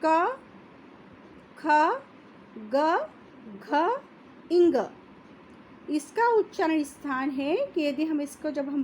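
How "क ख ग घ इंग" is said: each letter क, ख, ग, घ, इंग said apart with long pauses; slow letter demo. Shown as a demonstration. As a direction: spell out क ख ग घ इंग one by one, clearly. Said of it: "आप इसको उच्चारण करके देखिए". (कंठ से धीरे-धीरे उच्चारण)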